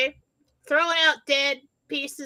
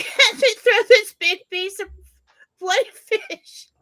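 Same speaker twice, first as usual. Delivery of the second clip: laughing while telling; 9 semitones higher, 7 dB louder, slower than usual. Real, not acted. Laughing through the story climax.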